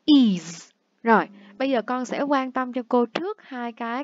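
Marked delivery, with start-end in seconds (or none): 0.1-0.7 Is đọc /iz/ to, dứt khoát; ending iz sound. Shown as a demonstration. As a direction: punch out is as iz. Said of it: "Cái thứ ba". (âm iz đọc mạnh)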